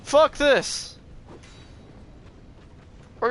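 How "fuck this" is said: frustrated outburst. Real, not acted. Frustrated at the game.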